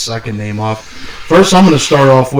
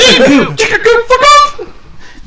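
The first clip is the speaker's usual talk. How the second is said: extremely high, laughing squawk; mock outrage; slower than usual. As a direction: screech it comically, way up high. Mock outrage at the steel cage.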